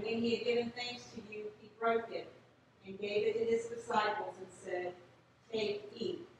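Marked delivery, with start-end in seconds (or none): none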